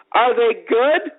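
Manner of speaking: high, slow, drawn-out fake curiosity; dripping with sarcasm. Lady mocking the hot dog line, dripping sarcasm. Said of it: "Just dripping with sarcasm".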